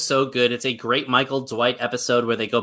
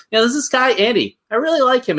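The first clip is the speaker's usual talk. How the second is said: pitched well up, a playful take on the boss gushing; imitating Michael's giddy fondness for Andy; Michael impression; 10 semitones higher, faster than usual. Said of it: "the him and Michael dynamic where he likes him".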